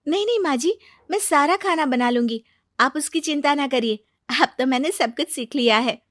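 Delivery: high-pitched, obliging daughter-in-law; eager young bride. Acted. New bride offers to cook for her mother-in-law, eager. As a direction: reassure her, starting high and easing down.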